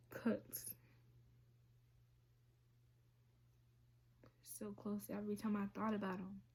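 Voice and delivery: hushed, fast whisper; almost no voice, admitting something painful; whispered confession. Real, not acted. Speaker confiding to viewers about self-harm, in a whisper.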